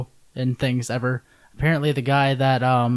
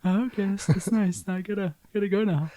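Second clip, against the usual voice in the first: awkward worker voice; 7 semitones higher than usual. Acted. Drive-thru worker skit.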